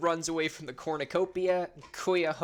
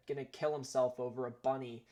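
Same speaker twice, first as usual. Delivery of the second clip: ordinary talk, nothing marked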